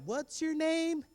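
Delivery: put-on high, bright kid asking; high classmate voice. Third graders asking the new kid his name. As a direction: ask like a curious classmate.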